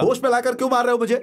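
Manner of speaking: high, fast, aggrieved question; panicked protest. Acted. Revived man questioning his attackers, panicked protest. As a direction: protest in a rush of alarm.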